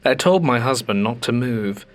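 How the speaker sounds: ordinary delivery, nothing marked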